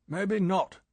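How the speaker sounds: brisk impatient retort. A narrator's reading. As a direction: cut him off impatiently. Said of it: "said M impatiently".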